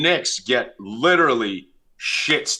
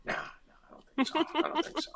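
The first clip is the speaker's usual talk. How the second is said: squeaky high mock reply, much softer; 12 dB quieter, faster than usual. Host mocking co-host's answer in a squeaky falsetto.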